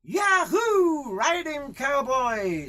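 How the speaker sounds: very high cowboy holler; rowdy spectators' yell, drawn out high; whooping cheer. Acted. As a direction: whoop and egg the rider on. Boys cheer the bucking rider.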